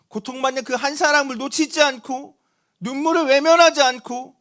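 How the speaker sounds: fervent preaching. Acted. Pastor preaching to the congregation about Jesus seeing the widow, fervent. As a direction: preach it with rising fervor to the congregation.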